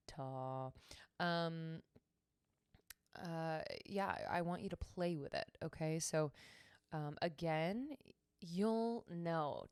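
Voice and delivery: ordinary delivery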